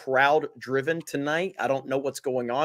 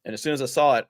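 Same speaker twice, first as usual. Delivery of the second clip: normal talking voice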